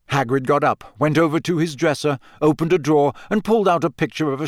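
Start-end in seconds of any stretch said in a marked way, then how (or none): none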